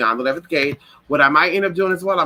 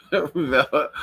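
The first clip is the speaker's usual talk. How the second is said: ordinary talk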